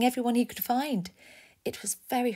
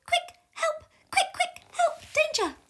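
very high, urgent bird squawks; alarm cry; 5 dB louder than usual. Acted. Warbler warns of danger, shrieking alarm cries. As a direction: fire out short high-pitched warnings. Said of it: "woken by a loud cry".

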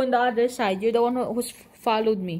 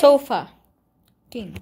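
very slow So fucking, split by a pause; drawn-out syllables; 6 dB louder, much slower than usual. Real, not acted. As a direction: stretch So fucking out, holding back the last syllable. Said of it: "you have to say it fast".

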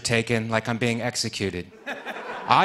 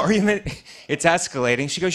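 ordinary delivery

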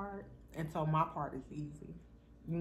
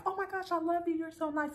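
mock gushing, much higher; gushing fan; 12 semitones higher than usual. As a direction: squeal it like an adoring admirer. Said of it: "they're like".

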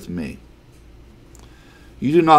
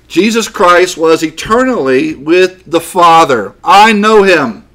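loud, raised-pitch sermon delivery; forceful preaching; 6 semitones higher, 7 dB louder than usual. Real, not acted. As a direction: proclaim it to the congregation, weighting key words.

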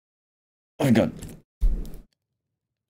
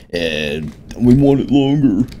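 mock whiny voice; 4 semitones higher, 6 dB louder, slower than usual. Mocking a viewer's request.

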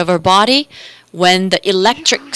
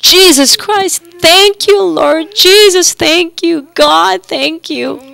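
retold weeping; 7 semitones higher than usual. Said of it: "she cried, she wept".

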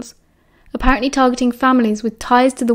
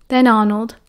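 usual speaking voice

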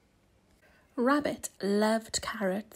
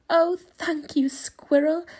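grateful; 5 semitones higher, 5 dB louder than usual. A narrator's reading. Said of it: "said Rabbit".

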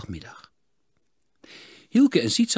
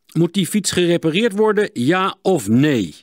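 1 demanding; 9 semitones higher, 9 dB louder than usual. A narrator's reading. Smith demands answer.

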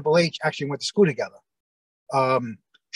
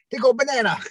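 much higher, hammed-up mock accent; Pat Patterson impression; 9 semitones higher than usual. Picturing Pat describing the crowd, hammed-up mock accent. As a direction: imitate him saying "They go banana" with a thick mock accent. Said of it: "I can just picture Pat".

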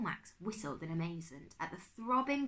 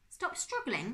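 higher-pitched incredulous question; indignant echo; 7 semitones higher, slower than usual. A narrator's reading. Uncle Max retorts from the rat trap, indignant. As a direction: throw the words back, affronted.

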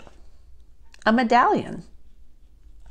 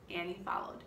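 usual speaking voice

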